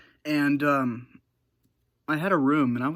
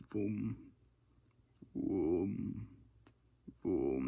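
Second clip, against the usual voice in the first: imitated low booming; 6 semitones lower, 9 dB quieter, much slower than usual. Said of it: "if it had a sound ... it would be like".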